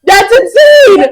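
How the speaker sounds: "That's insane" said high, slow and loud through laughter; laughing shout. Real, not acted. Laughing disbelief louder.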